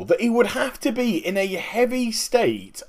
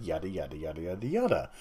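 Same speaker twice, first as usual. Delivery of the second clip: low, soft trailing yadas; tossed-off low filler, very quiet; dismissive mumble; 11 semitones lower, 13 dB quieter, faster than usual. Real, not acted. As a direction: rattle it off under your breath. Tossing off the rest as filler.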